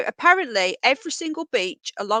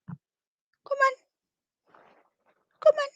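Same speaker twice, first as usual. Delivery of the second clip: very high, louder coaxing pet call; treat voice; 11 semitones higher, 5 dB louder than usual. Real, not acted. Coaxing the rabbit over with the high treat voice. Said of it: "this is the treat voice".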